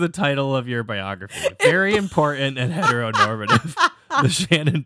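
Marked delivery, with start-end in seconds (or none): 1.6-4.8 laughing. Real, not acted. Repeats phrase, laughing.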